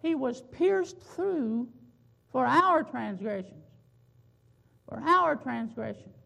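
slow, loud, each word set apart; emphatic preaching. Acted. Preacher hammers home "wounded for our transgressions".

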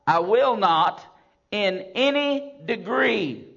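loud, slow, ringing scripture reading; thundering sermon recitation; full-force preaching. Acted. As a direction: proclaim each promise slowly to the congregation.

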